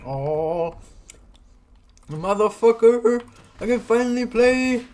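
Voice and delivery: high, loud triumphant yelling; excited shout. Real, not acted. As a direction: yell with pure relief and joy. Game finally works, elated.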